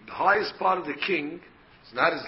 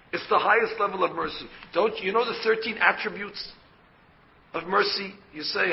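usual speaking voice

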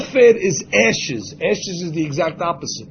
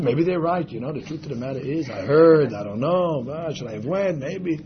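low, softened second-guessing; wavering self-doubt; 4 semitones lower, 5 dB quieter than usual. Acted. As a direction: act out someone losing nerve under ridicule. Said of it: "you're gonna get affected".

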